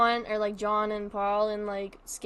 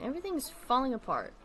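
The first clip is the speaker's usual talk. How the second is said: normal talking voice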